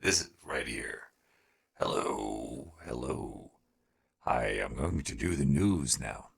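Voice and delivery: very low, quiet filtered voice; robotic deep voice effect; deep voice changer. Real, not acted. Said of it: "is this a news reading voice".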